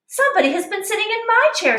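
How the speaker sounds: mother bear, quick and high; middle-sized bear voice. Acted. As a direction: pitch up and hurry as mama bear. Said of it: "in her middle-sized voice".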